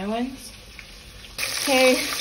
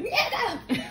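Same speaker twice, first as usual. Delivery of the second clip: imitating Auntie Fee; 13 semitones higher than usual. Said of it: "she would be like".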